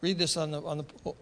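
ordinary delivery

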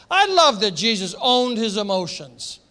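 normal talking voice

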